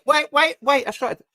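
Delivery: high, loud wait wait; excited interruption. Real, not acted. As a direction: jump in to stop them. Cuts in during chocolate argument.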